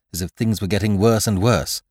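plain reading voice

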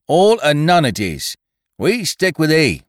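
loud, high, angry declaration; angry cry. A narrator's reading. He holds the men to Winter's side, angrily. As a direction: cry it out hotly. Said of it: "he turned on them angrily".

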